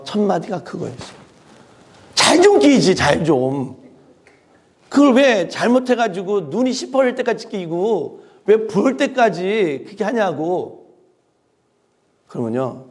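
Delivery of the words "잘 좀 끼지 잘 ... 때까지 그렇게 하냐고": angry scolding. Retold or imitated. Father scolding his daughter about her eye, irritated.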